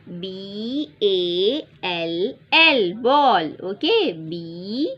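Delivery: letters stretched one by one; slow spelling out. Shown as a demonstration. Teacher spelling ball slowly for children. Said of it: "इसका spelling क्या है बेटा".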